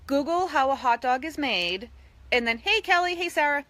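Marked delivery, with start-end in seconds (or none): none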